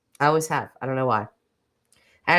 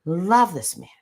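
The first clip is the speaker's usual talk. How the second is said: usual speaking voice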